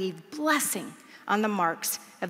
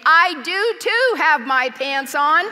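slow, louder insisting; mild mock insistence; 4 semitones higher, 6 dB louder, slower than usual. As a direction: stubbornly repeat from down the hall. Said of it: "I hear her saying".

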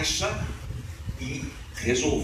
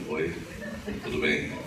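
puffed-up deep voice; 14 semitones lower, 5 dB quieter than usual. Retold or imitated. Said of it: "peito de pombo".

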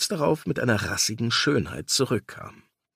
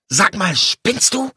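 louder, higher, slow angry rebuke; snapping at him; 9 semitones higher, 6 dB louder, slower than usual. Acted. Lawyer snaps at his partner at the lifts, louder. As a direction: bite his head off. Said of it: "Fuhr ich ihn an".

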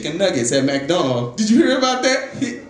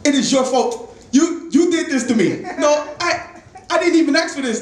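high-pitched mock outrage; mad customer; 10 semitones higher than usual. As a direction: accuse them in a raised, worked-up pitch. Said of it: "He gets mad at me".

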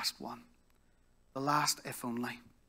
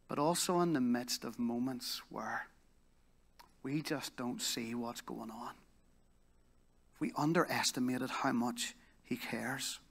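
ordinary talk

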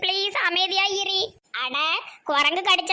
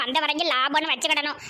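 ordinary talk

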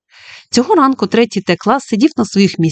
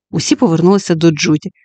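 usual speaking voice

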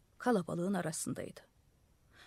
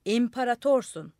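loud, higher call from below; 4 semitones higher, 7 dB louder than usual. A narrator's reading. Crowd calling from below to the madman, loud. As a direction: call it up to him. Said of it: "Aşağıdan bağırdılar".

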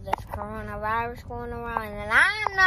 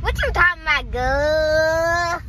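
ratchet talk; 3 semitones higher, 4 dB louder than usual. Pretend. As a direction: throw back a put-on sassy retort. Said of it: "talking that like that".

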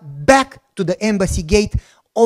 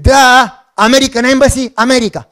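loud exasperated duh, higher; mock exasperated; 5 semitones higher, 8 dB louder than usual. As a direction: answer like it's obvious, loudly. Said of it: "I said".

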